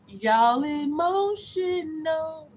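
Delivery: drawn-out mock quote. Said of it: "The lady said it".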